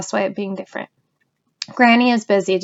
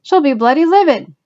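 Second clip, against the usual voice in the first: higher, louder, aghast granny; alarmed grandmother; 6 semitones higher, 5 dB louder than usual. A narrator's reading. Granny warns the policeman, high and aghast. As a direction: sound horrified at the thought.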